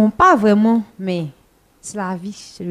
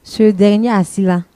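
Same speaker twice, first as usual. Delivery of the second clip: ordinary talk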